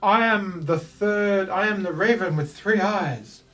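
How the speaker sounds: a grand puppet introducing itself. Acted. Puppet Augustus greets the adventurers grandly. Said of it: "this voice comes from the puppet".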